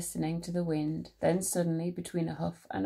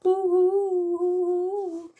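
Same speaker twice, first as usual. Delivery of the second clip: long, high, loud hoots; hooting owl call; 11 semitones higher, 8 dB louder, much slower than usual. A narrator's reading. Narrator imitates someone calling in the night, high hooting. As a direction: hoot high like a ghostly owl. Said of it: "someone calling".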